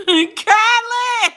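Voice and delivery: loud, high laughing call; laughing shout. Real, not acted. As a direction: call out the name mid-laugh.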